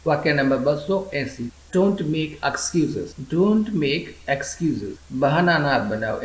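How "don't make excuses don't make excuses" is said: extremely slow, each word drawn out for learners; slow model reading. Shown as a demonstration. Teacher repeating an English phrase for learners, extremely slow. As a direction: stretch every syllable so students can repeat.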